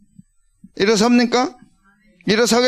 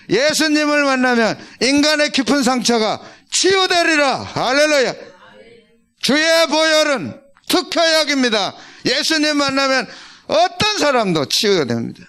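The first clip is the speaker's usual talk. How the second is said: preacher proclaims healing to the congregation, fervent and high; full force preaching; 5 semitones higher than usual. Acted. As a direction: preach it out with rising fire. Preaching climax, hallelujah.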